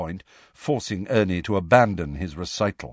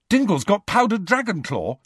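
high, keen and hopeful; eager; 11 semitones higher than usual. Acted. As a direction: perk up at the news. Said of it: "said Ron eagerly".

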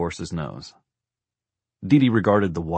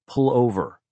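higher, sharp order; no-refusal command; 6 semitones higher, 4 dB louder than usual. A narrator's reading. Wife cutting off her husband, she-who-must-be-obeyed tone. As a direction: cut in with an order that allows no argument. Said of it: "defcon one tone that brooked no refusal".

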